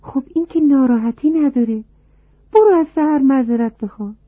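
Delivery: high, light mother voice; mother gently nudging her daughter to apologize, high and light; mother's voice. Acted. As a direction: play the mother, lifted and airy. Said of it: "مادر گفت".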